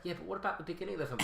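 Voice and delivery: fast, high nitpicking fanboy. Retold or imitated. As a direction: rattle it off like a nitpicker. Imitating fanboy comeback.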